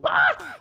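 startled shriek. Real, not acted. Sudden yelp after dying.

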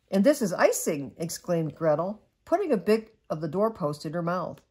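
gentle storybook read-aloud; cosy bedtime story narration; reading to children. Acted. Mother reads bedtime story.

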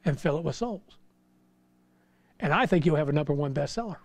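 ordinary talk

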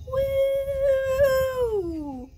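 high, loud whoop of joy; excited cheer. Real, not acted. Celebrating braces off, cheering.

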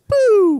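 very loud, high "boo" blast; misfire noise. Shown as a demonstration. Showing how the tone misfired, explosive mock bang. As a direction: imitate a shot going off wrong. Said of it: "went out the muzzle wrong".

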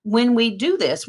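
ordinary delivery

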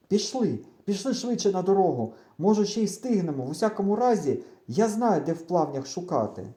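rally a friend to hurry; urging on. A narrator's reading. Friend jumping up to resume the chase, urging on. Friend jumps up, urges onward.

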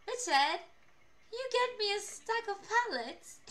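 high, put-on British accent. Streamer re-enacts asking coworker for pallets, high British accent. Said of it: "why do you always have to have a British accent".

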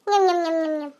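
soft munching sounds, fading; quiet greedy chewing, low; chewing noises. Acted. Keeps munching peanuts.

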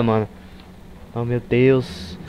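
ordinary talk

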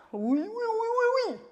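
high, slow game-show host cheer; hammed-up quiz host oui oui; Julien Lepers impression. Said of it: "Julien Lepers, j'aime beaucoup cette imitation".

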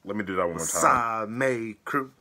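ordinary talk

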